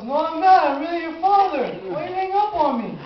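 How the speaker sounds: high insistent pleading voice; pleading father. Retold or imitated. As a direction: insist you're really his dad. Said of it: "He goes".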